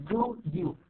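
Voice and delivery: "do you" as two full separate words; long full form. Shown as a demonstration. Teacher showing students the long form, "do you" fully pronounced. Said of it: "now the long one is".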